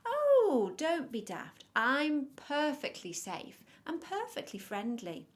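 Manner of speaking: high, loud, over-friendly reassurance; wolf character. A narrator's reading. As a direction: brush off the child's worry with a big swooping "oh". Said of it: "said Mister Wolf".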